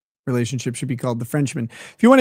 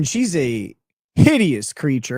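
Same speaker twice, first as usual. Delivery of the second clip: ordinary talk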